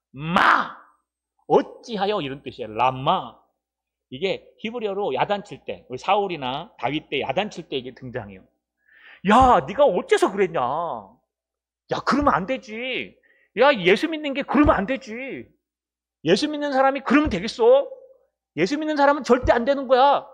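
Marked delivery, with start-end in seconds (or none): 0.1-2.2 loud, high reproachful burst; acted scolding. Preacher demonstrates a Hebrew word of rebuke, scolding. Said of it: "이게 히브리어로 야단치는 거예요". 9.3-20.3 high, loud, outraged telling-off; acted scolding. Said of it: "우리를 야단치는 거예요".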